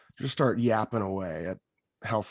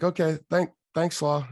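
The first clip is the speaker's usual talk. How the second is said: mock cheerful; 6 semitones higher, 3 dB louder than usual. Club pro brushed off.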